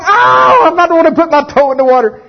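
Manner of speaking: shouted refusal. Acted. A believer refusing God's instruction, shouting high and loud. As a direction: yell the refusal, hammed-up.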